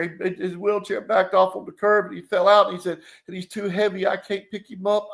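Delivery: put-on high-pitched pleading little boy, a touch of tears; a lightly voiced scared kid begging a stranger to help his brother; crying child. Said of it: "The kid's crying".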